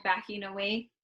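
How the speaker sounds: usual speaking voice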